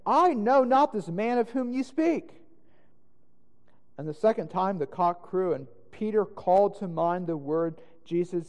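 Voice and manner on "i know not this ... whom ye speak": louder, higher, emphatic oath; vehement sworn denial. A narrator's reading. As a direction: deny it forcefully, as if swearing. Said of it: "began to curse and to swear".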